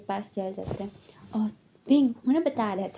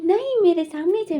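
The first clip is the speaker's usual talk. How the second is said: angry; 6 semitones higher, 6 dB louder than usual. Retold or imitated. Angry argument on phone.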